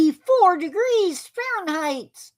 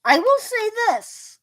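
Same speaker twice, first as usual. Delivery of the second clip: through a face mask; 9 semitones higher than usual. Real, not acted. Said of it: "Try talking through a mask".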